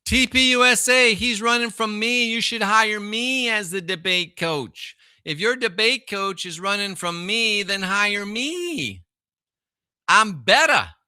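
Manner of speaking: cocky shout at a rival; hyped call-out. Real, not acted. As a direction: brag it loud like a challenge. Calling out a rival.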